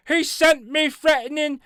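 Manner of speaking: high, whiny complaining voice; Karen voice. Retold or imitated. Mocking the complainant's letter in a Karen voice. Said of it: "get my Karen voice on".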